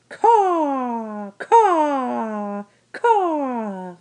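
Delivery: high, loud mocking crow calls; crow caw. Edward imagines laughing at the man.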